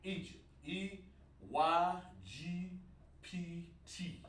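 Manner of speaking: Egypt spelled out letter by letter, E Y G P T, softly; a quiet, dictated letter-by-letter spelling. Shown as a demonstration. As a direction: spell the word slowly while writing it down. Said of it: "Let's write that word down".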